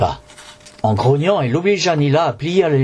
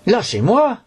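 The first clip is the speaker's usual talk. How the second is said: mock shout; 9 semitones higher than usual. A narrator's reading. Boy yells at the mummy's hand on his shoulder. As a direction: yell it as a cold hand grips. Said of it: "hurlai-je".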